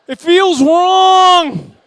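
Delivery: very loud, high, drawn-out cry; anguished mock wail. Mennonite made to dance by a video game, mock wail. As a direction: wail it out slowly in hammed-up distress.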